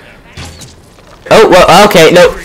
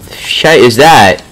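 ordinary talk

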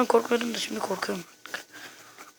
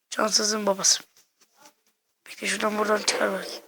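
ordinary talk, nothing marked

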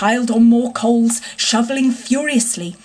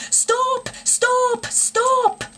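mimicked distant shouting; much slower than usual. A narrator's reading. Engine driver relaying the pursuers' cries, mimicked shouting. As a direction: imitate far-off shouts high up. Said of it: "all shouting the same thing".

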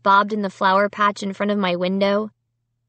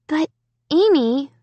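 higher-pitched, pleading objection; mild whiny protest; 7 semitones higher than usual. A narrator's reading. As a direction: start to object, then lift the name in protest. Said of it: "Margot protested".

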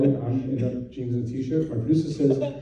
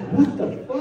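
much higher, laughing what-the; stunned disbelief; 11 semitones higher than usual. Retold or imitated. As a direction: blurt it out in shock. Stunned by sudden success.

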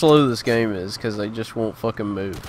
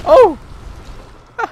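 high, loud sudden yelp; startled cry; 11 dB louder than usual. Real, not acted. Gamer startled by an enemy, loud startled cry. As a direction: jump at the shock.

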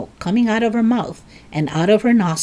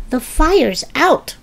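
higher, slow proud declaration; proud announcement; 8 semitones higher, slower than usual. A narrator's reading. George after dousing Grandma's smoke, proudly announcing. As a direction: announce it proudly, taking your time. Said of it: "George announced proudly".

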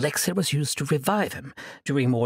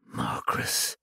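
breathy whispered name. Acted. Sorceress whispering the name to her captive, menacing. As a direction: whisper the name low and slow.

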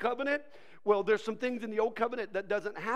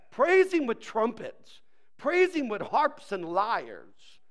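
slow, raised-voice psalm proclamation; emphatic preacher's reading; exclaimed scripture; 3 semitones higher, 6 dB louder, slower than usual. Acted. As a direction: declare each line deliberately.

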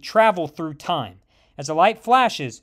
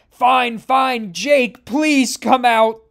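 higher, louder, drawn-out giving in; pressed hero calls out his other self; urgent plea; 5 semitones higher, 6 dB louder, much slower than usual. A narrator's reading. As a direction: cave in and call him out, loudly. Hero gives in mid-fight.

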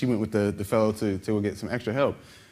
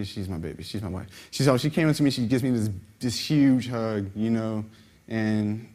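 usual speaking voice

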